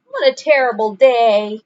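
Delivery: loud, despairing cry; wailing. A narrator's reading. Franklin wailing after the ruined sled ride. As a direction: complain loudly to the sky. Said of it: "wailed Franklin".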